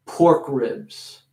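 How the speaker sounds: ordinary talk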